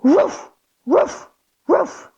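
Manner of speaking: short barking woofs; dog barks. Shown as a demonstration. Teacher leads kids barking in downward dog. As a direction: bark like a playful puppy. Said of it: "three big barks".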